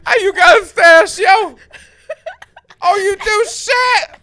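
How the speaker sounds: high, loud laughing East Coast mimicry; imitating East Coast accent. Mocking cohost's line, loud East Coast accent. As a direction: copy the tough-guy ask, shouting through laughs. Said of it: "sounds like you're asking someone on the East Coast".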